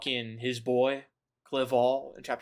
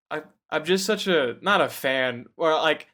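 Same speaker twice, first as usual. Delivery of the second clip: usual speaking voice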